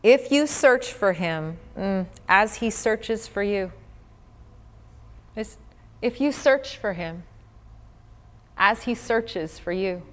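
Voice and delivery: scripture reading. Acted. Reads scripture.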